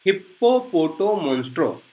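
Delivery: हिप्पोपोटोमोन्स्ट्रो said softly, syllable by syllable, hip-po-po-to-mon-stro; quiet teacher modelling हिप्पोपोटोमोन्स्ट्रो; slow pronunciation demo. Shown as a demonstration. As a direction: spell out हिप्पोपोटोमोन्स्ट्रो gently for students to repeat. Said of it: "मेरे साथ आप pronounce करने की कोशिश कीजिए".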